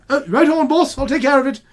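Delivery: high, loud voice through a mouthful; mouth full. Acted. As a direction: talk around a turkey leg. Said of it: "which he says through a mouthful of turkey leg".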